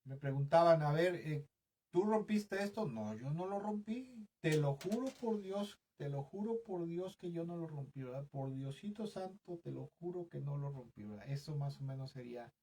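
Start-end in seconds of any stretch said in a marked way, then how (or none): 0.8-2.8 a hint of a suspicious parent asking, pitched high; a parent questions a child who broke something, lightly imitated; stern parent. 2.8-11.0 lightly imitated child, pitched high, pleading innocence; a child swears to his parents that he didn't break it, mildly pleading; child swearing.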